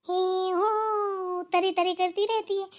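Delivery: high, drawn-out mocking whine; mocking sing-song. To the mother-in-law about her pet, mocking whine. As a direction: mimic her fussing to belittle her.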